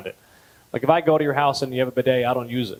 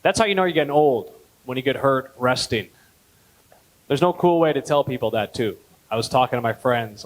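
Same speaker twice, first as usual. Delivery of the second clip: ordinary talk, nothing marked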